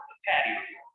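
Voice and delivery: ordinary delivery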